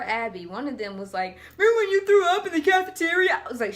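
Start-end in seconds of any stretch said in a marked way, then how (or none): 1.6-3.4 higher, louder, fast teasing; teasing friend. Retold or imitated. Friend reminiscing in high school, teasing. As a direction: needle her about the old story.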